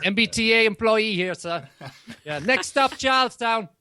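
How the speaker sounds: high-pitched Boston transit announcer; Boston accent. Retold or imitated. Tram employee to passengers, mock Boston accent. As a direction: call out the stop like a Boston tram driver. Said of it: "I love the accent".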